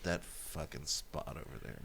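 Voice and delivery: low, slow, muttered under the breath; muttered annoyance. Retold or imitated. To himself about a paint spot, low muttered grumble.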